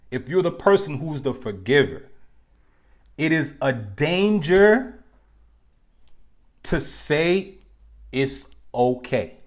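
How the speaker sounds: slow, deliberate and clear; slow teaching emphasis, each phrase spaced out; nice and slow. Shown as a demonstration. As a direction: spell it out slowly so it sinks in. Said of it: "I'm gonna say this nice and slow and clear".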